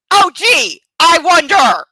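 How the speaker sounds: loud, high mocking retort; sarcastic shout. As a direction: yell it back sarcastically. Sarcastic outburst in argument.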